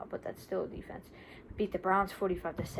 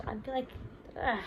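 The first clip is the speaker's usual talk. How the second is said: ordinary talk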